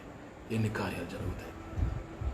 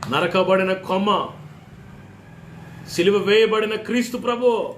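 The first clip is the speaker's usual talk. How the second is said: high, loud and slow, every word weighed; slow preached proclamation; 9 semitones higher, 7 dB louder, slower than usual. Acted. Pastor proclaiming the crucified Christ, high, loud and slow.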